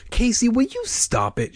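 high, exasperated sister's cry; fed-up squeal without full volume; mild scream. A narrator's reading. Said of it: "she screamed".

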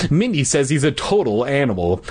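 ordinary delivery

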